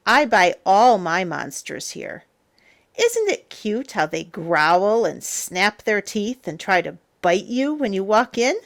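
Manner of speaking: ordinary delivery, nothing marked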